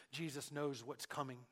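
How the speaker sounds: ordinary talk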